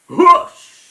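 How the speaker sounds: loud high whoosh like a passing train; whoosh sound effect. Retold or imitated.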